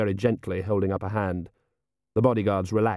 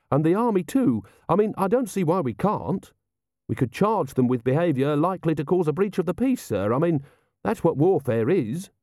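high, eager character voice; innocent, helpful logic in a higher voice; earnest young officer; 8 semitones higher than usual. Acted. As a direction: reason it out keenly, pitched well up. Carrot reasoning earnestly with Vimes.

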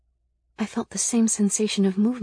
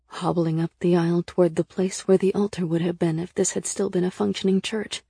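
ordinary delivery, nothing marked

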